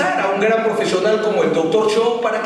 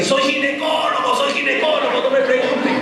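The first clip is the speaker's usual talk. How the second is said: higher, insistent mock yell of his specialty; hammed-up yelling expert; mock shouting; 7 semitones higher than usual. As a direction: blurt it out before anyone asks. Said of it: "se entró gritando así".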